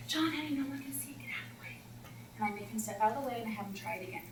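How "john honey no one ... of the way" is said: soft whisper; whispered prompt. Retold or imitated. Coach whispers to the student leader to move, soft. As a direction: tell him gently and quietly to step aside. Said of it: "And I say".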